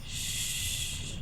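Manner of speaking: soft, long "shh"; soothing shush. Acted. Creature comforting a crying former kid, soothing shush. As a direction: hush them gently, very softly.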